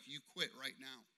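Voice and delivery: low, hushed, firm command; retold divine prompting, quiet and low; Holy Spirit speaking. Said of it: "the Holy Spirit was like".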